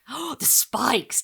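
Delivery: slow, savoring gleeful oh; relishing. A narrator's reading. Boy relishing the gruesome plan, slowly. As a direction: draw it out with relish.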